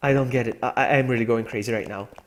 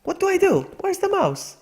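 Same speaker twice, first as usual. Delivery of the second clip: panicked. Real, not acted. Panicking over the mouse.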